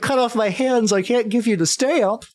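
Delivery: high whiny clown pleading; sad clown voice. Acted. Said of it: "the sad head pops on".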